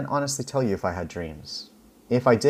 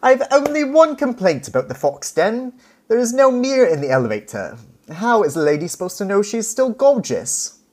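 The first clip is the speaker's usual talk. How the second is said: high, loud imitation of a British Italian lady; British Italian accent, much higher and louder; 10 semitones higher, 6 dB louder than usual. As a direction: play Valentina with a grand British Italian accent. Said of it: "in her British Italian accent".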